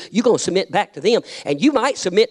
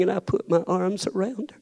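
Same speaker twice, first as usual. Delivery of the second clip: ordinary delivery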